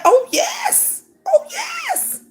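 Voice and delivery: high-pitched, drawn-out oh yes; real high-pitched insistence; emphatic affirmation. Real, not acted. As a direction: stretch it up high, insisting it's true. Emphatic high exclamation backing her point.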